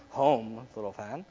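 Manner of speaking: low, very soft take; practising the line. Acted. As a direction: try it deep and hushed. Trying out ways to say it.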